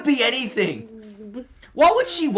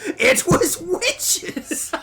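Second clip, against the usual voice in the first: a slow, high mock pronouncement; drawn-out it was witches; 10 semitones higher, much slower than usual. Friend blaming witches for the sub deal, very slow and high. Joking conspiracy blame.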